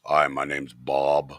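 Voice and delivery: mock straight-guy introduction; deep macho voice. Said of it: "he's like".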